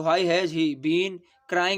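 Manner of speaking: very slow, word by word; dictating slowly. Shown as a demonstration. Teacher dictates the answer to students, very slowly. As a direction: spell it out for the class. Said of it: "teacher dictating the English answer".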